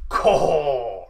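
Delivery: loud cawing bird call; loud crow caw. Acted. Vlogger clowning for camera, loud crow caw. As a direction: squawk like a crow.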